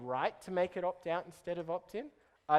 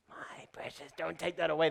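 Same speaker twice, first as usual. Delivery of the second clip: hoarse creature whisper, clutching; Gollum whisper. Retold or imitated. Whispered Gollum voice clinging to a decision. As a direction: hiss it like a creature guarding treasure. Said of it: "Gollum voice for clinging to decisions".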